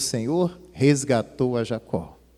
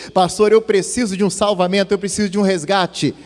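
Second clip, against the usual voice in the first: higher, louder plea for rescue as a hurting believer; pleading church member; 7 semitones higher, 6 dB louder than usual. Acted. Hurting believer begging the pastor for help, pleading voice. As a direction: cry out like someone begging the pastor.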